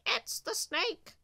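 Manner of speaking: high crow voice; raucous, excited bird cry; squawking crow. A narrator's reading. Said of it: "squawked crow".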